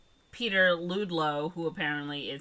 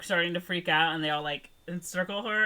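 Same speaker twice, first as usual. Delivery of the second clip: usual speaking voice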